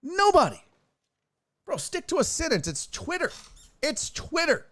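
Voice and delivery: high, scornful put-down; mocking exasperation. Real, not acted. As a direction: talk down to him. Mocking tweeter.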